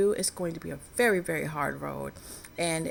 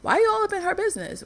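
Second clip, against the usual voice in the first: high, loud, fast mocking challenge; mock sassy viewer asking why she's nosy; nosy viewer's retort; 7 dB louder, faster than usual. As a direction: toss it back like a sassy heckler. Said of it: "so I know what you're saying".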